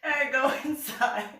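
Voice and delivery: high, loud, through laughter; laughing. Real, not acted. Telling how she went inside, laughing. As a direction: struggle to get it out while laughing.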